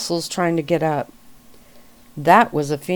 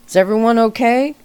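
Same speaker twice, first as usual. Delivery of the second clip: higher, louder call; 6 semitones higher, 6 dB louder, faster than usual. A narrator's reading. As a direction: shout across from the truck. Stranger calls out from truck.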